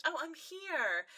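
high, slow, showy Rarity-style entrance; drawn-out posh arrival line; grand entrance. Retold or imitated. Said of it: "and she goes".

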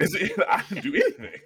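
laughing through the words. Real, not acted. Laughing as he protests he did nothing. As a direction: giggle while protesting innocence.

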